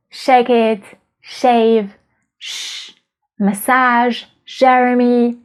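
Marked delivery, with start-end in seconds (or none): 2.4-2.9 "shh" as a voiceless, soft hushing consonant; isolated sh sound. Shown as a demonstration. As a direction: hold "shh" alone, without a vowel. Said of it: "The sh already exists".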